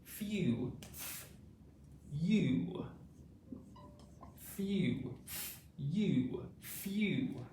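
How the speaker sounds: Few and You said slowly, one at a time, with long pauses; modelling few. Shown as a demonstration. Teacher drilling Few and You, slow and separate. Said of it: "the first word is few".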